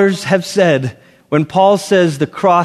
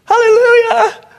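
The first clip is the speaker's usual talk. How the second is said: much higher, whooping mock praise; hammed-up hallelujah cheer; happy clappy worship. Preacher mocking happy clappy Christians, whooping high. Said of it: "we are happy clappy".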